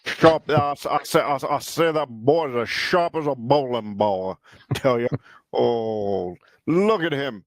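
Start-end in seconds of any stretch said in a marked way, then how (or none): 0.0-5.1 Foghorn Leghorn impression. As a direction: stutter I, I say like a blustering Southern rooster. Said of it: "I love the accent, the Southern accent".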